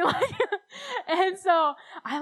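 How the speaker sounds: usual speaking voice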